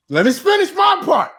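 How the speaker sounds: high, emphatic shout to be heard; shouted demand. Real, not acted. Storyteller to interrupting co-hosts, shouted protest. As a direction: raise the pitch sharply and insist.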